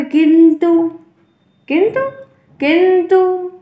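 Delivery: किंतु repeated loud and high with a short u at the end; teacher drills किंतु, clear short final u; किंतु, each time with a brief closing u; careful demo pronunciation. Shown as a demonstration. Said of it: "छोटे ऊ की मात्रा होगी".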